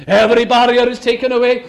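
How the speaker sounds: normal talking voice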